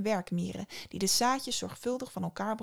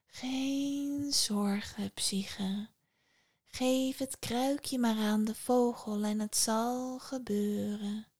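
slow airy whisper; the breeze soothing her; the wind reassuring Psyche, slow whisper; 2 semitones higher, much slower than usual. Acted. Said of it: "de wind in haar oren fluisterde".

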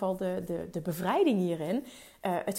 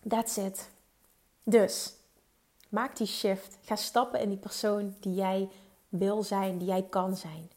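ordinary talk

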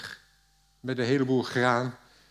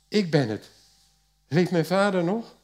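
higher, louder, moved; emotional reveal; 6 semitones higher, 5 dB louder than usual. A narrator's reading. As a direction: burst out with the news, choked up. Joseph reveals himself, weeping. (hoger en luider, ontroerd)

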